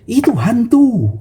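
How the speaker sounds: high, slow fearful ghost cry; scared cry. Acted. Disciples crying out at a ghost, fearful. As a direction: drag out the fear of a ghost. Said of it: "Lalu mereka berkata".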